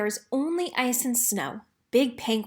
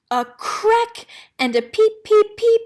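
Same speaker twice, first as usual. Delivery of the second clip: put-on squeaky baby penguin peeps, much higher and louder; high loud peeping chick; 11 semitones higher, 8 dB louder than usual. A narrator's reading. Narrator voicing the hatching chick, high loud peeps. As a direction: peep out like a newborn chick.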